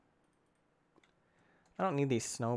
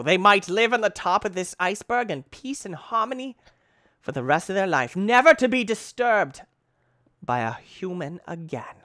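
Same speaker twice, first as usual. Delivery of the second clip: loud, swelling hammed-up proclamation; mock-grand; 5 semitones higher, 7 dB louder than usual. Gamer grandly pronouncing on the creatures left on the iceberg, mock-grand.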